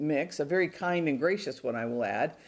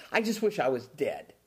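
pouting; 5 semitones lower than usual. Retold or imitated. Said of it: "he's pouting, he's murmuring".

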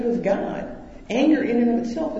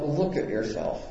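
muttered to self; 4 semitones lower, 6 dB quieter than usual. Retold or imitated. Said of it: "I said to myself".

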